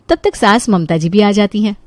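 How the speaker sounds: usual speaking voice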